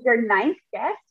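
ordinary delivery

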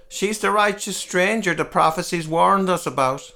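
frightened man in the town hall uproar; panicked cry. Acted. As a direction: cry it out in alarm.

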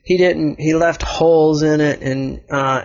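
ordinary delivery

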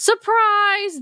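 high, slow mock reveal; spring the surprise slowly. Recapping a scary movie, drawn-out mock surprise. Jump scare in retold film.

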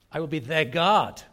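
"their God" punched out loud at the end; stress on THEIR GOD. Shown as a demonstration. Preacher repeating the new emphasis, stress on "their God". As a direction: hold back, then hit "their God" loud. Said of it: "what if I put the emphasis on".

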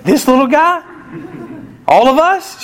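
high, loud disbelief; incredulous. Retold or imitated. Dad to the nurse, high and loud in disbelief. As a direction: squeak it out in shock.

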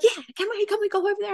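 put-on high, excited child voice; eager kids. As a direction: beg like a thrilled little kid. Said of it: "And they were like".

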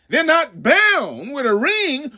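normal talking voice

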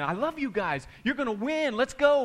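high, eager cheering; rally the team, happy and high; excited cheering. Retold or imitated. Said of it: "he kept saying".

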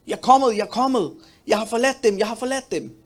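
breathless, high and loud arrival; panting mock messenger, pushed up high; out of breath. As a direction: gasp it out like a man who ran all the way.

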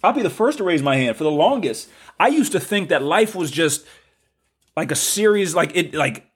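high, impassioned preacher-like delivery; getting going. Real, not acted. Host getting carried away before listeners, high-pitched preaching fervour. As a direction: raise the pitch and testify with rising fervour. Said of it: "I didn't want to start going already".

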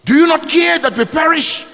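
high, urgent cry to the Teacher. Acted. Preacher voicing the disciples in the storm, crying out. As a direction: call out in alarmed appeal. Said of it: "as disciples cried out".

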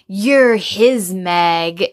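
slow, drawn-out mock taunt; a hint of a spiteful mother, slowed right down. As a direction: lean on each word like a sneering mother-in-law. Mother taunts Charlotte about Meg.

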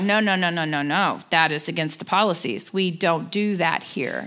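low, by-the-book nurse voice; retold firm refusal, dropping low in pitch; official refusal. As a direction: imitate staff citing policy, slowing at the end.

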